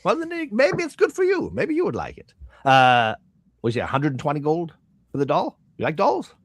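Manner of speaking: high, wheedling merchant voice; coaxing shopkeeper. Acted. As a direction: nudge the customer toward buying.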